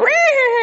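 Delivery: high snorting horse noise; playful imitated horse snort; horse snort imitation. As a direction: snort like a horse through the nose. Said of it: "horses go".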